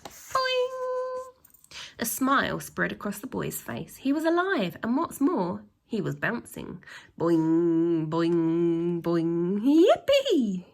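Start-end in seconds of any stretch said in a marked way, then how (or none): 0.0-1.8 long high cartoon boing; bouncy high boing sound. 7.2-9.6 loud. Acted. Repeated bouncing. 9.6-10.7 high loud yelp of joy; a delighted boy's high yippee. Acted. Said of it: "he yelped in delight".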